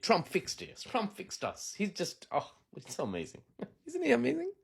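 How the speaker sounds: high, sing-song fake adoration dissolving into laughs; put-on swooning praise; mock gushing. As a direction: gush like a swooning fan, tongue in cheek.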